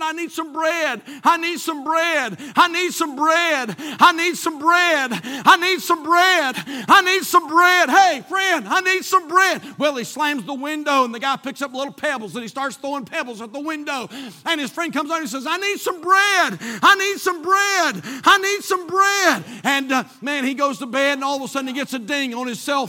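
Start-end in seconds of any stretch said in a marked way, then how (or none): 0.0-7.9 high, slow, drawn-out demand growing louder; relentless pleading that swells to a shout; insistent begging, building. Retold or imitated. Acting out relentless begging friend. 7.9-9.6 high, loud call to a friend; loud, nagging demand. Retold or imitated. Acting out friend calling up. 15.5-19.4 high, slow, drawn-out holler; loud relentless pleading; insistent shouting. Retold or imitated. Acting out friend begging again.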